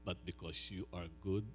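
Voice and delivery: soft, low, reverent prayer voice. Acted. Pastor praying softly over his people before preaching. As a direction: pray quietly over the congregation.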